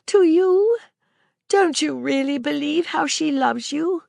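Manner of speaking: put-on a child asking anxiously; Maisie, young girl. A narrator's reading. Girl asking Sir Claude, lightly voiced child.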